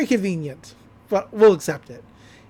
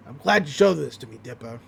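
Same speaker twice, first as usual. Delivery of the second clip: much lower gruff-ish read; deep, low Stan voice; 7 semitones lower than usual. Retold or imitated. Stan answering Dipper, voiced much lower. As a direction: drop the voice way down. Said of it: "The girl says, says".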